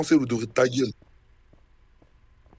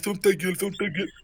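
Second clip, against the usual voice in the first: mocking imitation; 6 semitones higher, 3 dB quieter than usual. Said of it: "À part dire".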